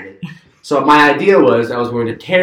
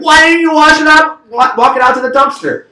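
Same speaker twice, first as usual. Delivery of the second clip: much higher and louder, exasperated berating; angry scolding; 7 dB louder than usual. Retold or imitated. Brother scolding the storyteller about the trash, angry scolding. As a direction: scold him for his carelessness.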